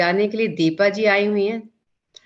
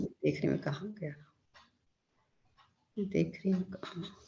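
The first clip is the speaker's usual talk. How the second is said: much softer, half to herself; absent self-talk; 14 dB quieter than usual. Real, not acted. Host searching for the co-host option, quiet self-talk. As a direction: murmur it while hunting for something.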